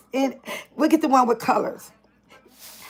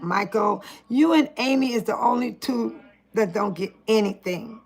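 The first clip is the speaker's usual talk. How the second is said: normal talking voice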